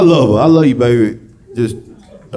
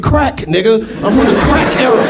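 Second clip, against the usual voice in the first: proud answer; 10 semitones higher than usual. Retold or imitated. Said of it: "I said".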